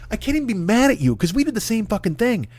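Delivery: ordinary talk, nothing marked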